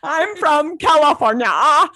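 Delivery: put-on loud, slow, high Valley girl line; mock Valley girl. Mocking how TV Californians talk, loud slow Valley girl. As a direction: drawl it out like a California teen. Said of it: "look at what I'm watching".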